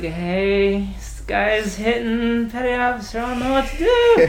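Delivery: hammed-up high-pitched panicky report; mock helpless plea. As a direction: imitate yourself phoning for help, out of your depth. Mimics own helpless call to police.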